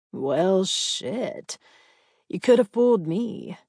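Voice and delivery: lightly voiced deep country twang. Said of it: "The deep twang".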